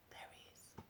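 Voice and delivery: hushed whisper. A narrator's reading. Narrator points Jack out to the children, whispered. Storyteller aside to listeners.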